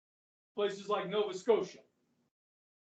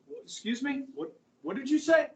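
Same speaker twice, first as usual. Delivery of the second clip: indignant Canadian; 5 semitones higher, 4 dB louder than usual. Retold or imitated. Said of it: "And he goes".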